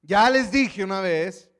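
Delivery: loud, slowed exclamation; healed man telling the Pharisees he already answered, loud exclamation; exclaiming loudly. Acted. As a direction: exclaim it like he's sick of repeating himself. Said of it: "exclamó el hombre".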